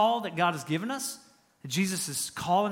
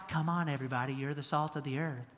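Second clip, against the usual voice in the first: soft, low, feeble voice; meek, frail Jesus; 6 semitones lower, 11 dB quieter, faster than usual. Acted. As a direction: play a skinny, frail Jesus, much lower and softer. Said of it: "that's kinda how we think about Jesus".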